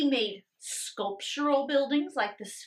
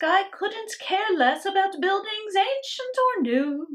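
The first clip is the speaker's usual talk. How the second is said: a hint of a prim, higher teacher voice; stern teacher; 8 semitones higher, 6 dB louder than usual. Teacher lecturing her class, lightly voiced stern teacher. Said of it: "she said in her lecture".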